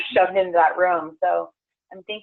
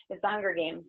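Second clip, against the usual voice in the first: normal talking voice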